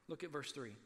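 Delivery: ordinary talk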